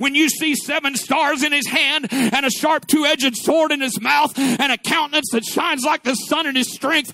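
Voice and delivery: driving, rhythmic sermon declamation; impassioned preaching. Real, not acted. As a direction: proclaim in rolling phrases, building in force.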